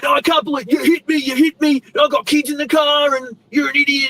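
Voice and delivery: high, rushed rant imitating an irate driver; mock angry yelling. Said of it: "he's yelling at me, going".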